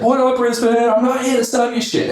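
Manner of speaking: high-pitched hype-man holler to a crowd; imitated stage shout. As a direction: call out to a big audience, mimicking a speaker bursting on stage. Re-enacts Gary's opening on stage.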